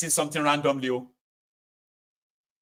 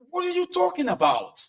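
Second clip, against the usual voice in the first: incredulous objection. Acted. Acting startled objection to agitator.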